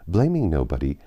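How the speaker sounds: ordinary delivery, nothing marked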